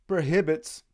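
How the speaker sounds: ordinary talk, nothing marked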